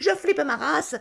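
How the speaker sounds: mock panic. Panicking at spider touch.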